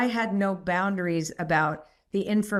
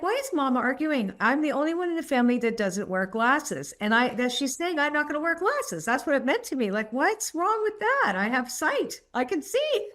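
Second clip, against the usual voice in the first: put-on much higher, bright childlike protest, laughing at the end; little girl voice; 8 semitones higher than usual. Adult recalls her childhood confusion, in a high child's voice. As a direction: play her puzzled young self, voice pitched way up. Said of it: "And I'm like".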